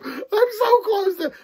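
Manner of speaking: laughing talk. Real, not acted. Laughing again.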